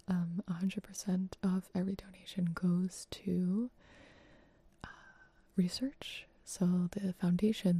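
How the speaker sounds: breathy close-mic whisper. Real, not acted. ASMR charity stream whisper.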